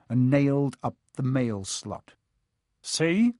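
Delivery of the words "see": talking around a mouthful of nails; mouth full. Acted. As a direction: say it through clenched nails. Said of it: "through a mouthful of nails".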